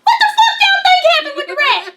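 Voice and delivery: high, loud laughing yell; laughing outburst. Real, not acted. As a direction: throw it back at them, cracking up. Exasperated outburst at fans.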